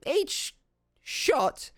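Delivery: name the price high and slow; goblin waitress voice. Acted. Goblin waitress quoting a steep price, high and slow. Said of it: "she smiles and she says".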